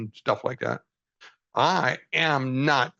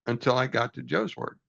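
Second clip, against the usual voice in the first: normal talking voice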